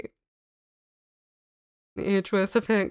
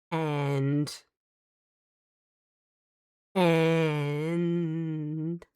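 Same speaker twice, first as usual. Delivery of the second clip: slow disbelief; 3 semitones lower, much slower than usual. Real, not acted. Stunned disbelief at the story.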